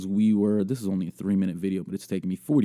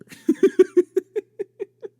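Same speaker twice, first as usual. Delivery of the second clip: high, loud, long giggle; real cracking up; 5 dB louder than usual. Real, not acted. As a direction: lose it at your own joke. Cracks up at dumpster joke.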